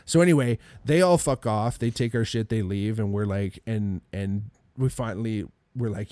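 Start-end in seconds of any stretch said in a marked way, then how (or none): none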